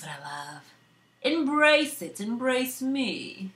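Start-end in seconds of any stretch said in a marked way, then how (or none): 1.2-3.5 loud, much higher embrace it, then slow embrace me; rally the viewers; excited plea to the audience; hyped call. Real, not acted. Hyped sign-off.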